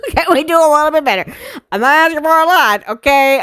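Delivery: fast, high-pitched comic whine; laughing, exasperated high-pitched begging; high pleading voice. Real, not acted. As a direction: plead quickly in a squeezed high voice. Repeats plea in high voice after laughing.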